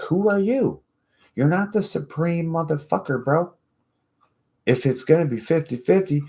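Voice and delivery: high-pitched, heated telling-off; indignant rant. Acted. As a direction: go off on someone who can't take it back. Acted rant at hypocritical opponent.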